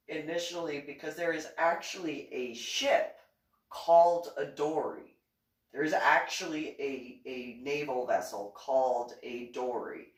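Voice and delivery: ordinary delivery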